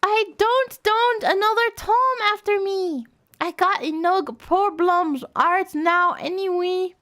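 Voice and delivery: a hint of a flustered young she-cat; lightly voiced Starpaw. Voicing Starpaw.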